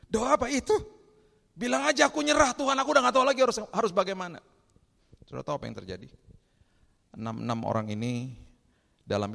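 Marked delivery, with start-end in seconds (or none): 0.1-0.7 high, loud mock bewilderment; baffled question. Acting the person's puzzled reply. 1.5-4.4 high, shouted cry of surrender; desperate prayer. Acted.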